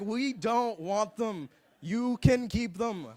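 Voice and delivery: retold refusal, laboriously spelled out; emphatic refusal. Refusing squeezed bananas in retold scene.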